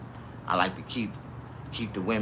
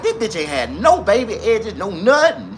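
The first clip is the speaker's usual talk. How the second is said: normal talking voice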